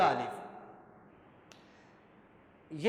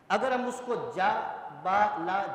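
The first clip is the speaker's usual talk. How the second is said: usual speaking voice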